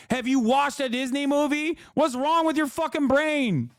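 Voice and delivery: high-pitched, incredulous scolding; exasperated challenge. Real, not acted. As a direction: demand it in outraged disbelief.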